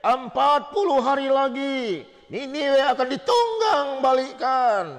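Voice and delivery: high, very slow drawn-out prophet's warning; Jonah preaching. Retold or imitated. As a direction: proclaim the doom slowly like a stern prophet. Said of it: "Dia menginjil". (seruan nabi yang lambat dan tinggi)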